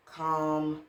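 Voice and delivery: usual speaking voice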